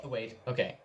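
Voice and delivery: plain reading voice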